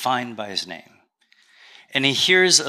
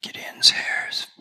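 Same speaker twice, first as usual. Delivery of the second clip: whisper; 4 dB quieter than usual. Retold or imitated. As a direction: breathe it quietly to the side. Grandmother leans over to speak.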